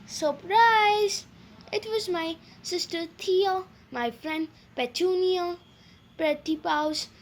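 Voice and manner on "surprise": squeaking. A narrator's reading.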